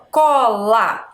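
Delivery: "Cola" in two held beats, CO, LA; split into syllables. Shown as a demonstration. Teacher counting on a finger, "Cola" syllable by syllable. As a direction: sound out "Cola" slowly, one syllable at a time. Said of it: "Posso colocar o dedinho aqui perto".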